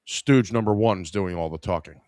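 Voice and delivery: usual speaking voice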